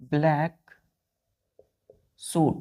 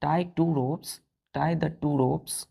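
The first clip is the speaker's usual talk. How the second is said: slow dictation; 3 dB quieter, much slower than usual. Shown as a demonstration. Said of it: "dictating example sentence".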